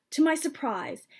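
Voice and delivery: ordinary delivery, nothing marked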